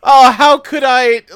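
sarcastic. Acted. Sarcastic reply to friend.

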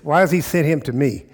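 usual speaking voice

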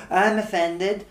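high-pitched self-righteous protest; mock indignation. Comedian mocking people who always take offence, high mock-outraged whine. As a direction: pipe up as the aggrieved complainer.

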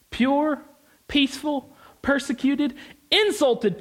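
tongue-in-cheek cheerleader list; mock hype. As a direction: sell it as a party invite. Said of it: "That sounds like a party".

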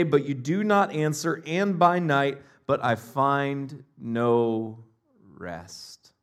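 rising anguished reading of a psalm, softening to a hush at the end; a psalm lament read with feeling. Acted. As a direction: pray the lament aloud.

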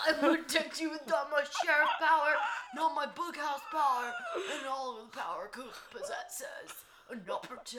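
high, loud, strained vow; Harry Truman. Acted. Sheriff vowing to protect Josie, strained overwrought voice. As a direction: overwrought promise in a put-on voice. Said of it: "I was trying to do, like, Harry Truman".

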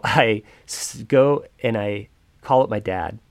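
ordinary talk, nothing marked

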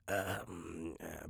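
soft, strained groan of a tired voice; strained hoarse groan. Retold or imitated. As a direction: groan like a singer whose voice is spent. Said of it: "my voice is just a little bit more like".

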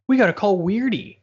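lightly mocking, lifted quote; mock insistence. Mimicking characters who insist on a nickname, mock insistence.

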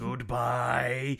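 long drawn-out spooky goodbye; creepy voice. Shown as a demonstration. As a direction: stretch it out like a ghost. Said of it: "In my creepy voice".

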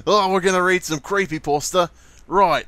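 loud, high Australian caricature; mock Australian accent. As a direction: ham up the Aussie accent at full voice. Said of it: "You kinda sound Australian there".

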